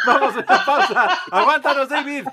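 laughing. Real, not acted. Cutting to break, laughing.

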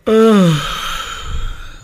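high-starting yawn that sinks. Shown as a demonstration. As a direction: yawn openly to set everyone off. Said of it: "gonna get you guys yawning right now".

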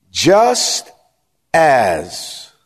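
"Just as" stretched out for close attention; drawn-out emphasis. Real, not acted. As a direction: split "Just as" into two long beats. Slows verse after "watch closely.

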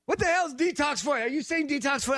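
high-pitched incredulous questioning; retold bafflement. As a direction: re-enact calling back, totally baffled. Still baffled, calling back to her.

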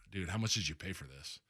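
low, hushed retold question; imitating a softly concerned friend; feigned concern. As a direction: ask it gingerly, voice dropped. Retells Griffey's feigned concern.

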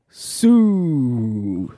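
long drawn-out "Sue"; imitated crowd chant. Explaining what the crowd says, drawn-out chant. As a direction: stretch the word out like a crowd call. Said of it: "they're saying".

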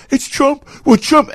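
mocking gullible voters. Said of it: "people are gonna go".

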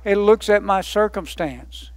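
ordinary talk

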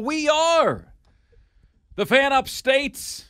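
loud, slow, high radio announcer call; big announcer shout. Acted. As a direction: belt the station name out like a hype man. Shouted station ID.

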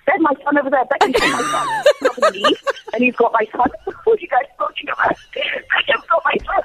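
hammed-up high panic breaking into laughter; frantic. Mother confronting security guards about her son, acted frantic protest. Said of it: "I went into that frantic mode again".

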